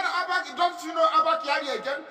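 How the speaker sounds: high, incredulous imitation; mock incredulous reply. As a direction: act them amazed he is unknown. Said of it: "He said".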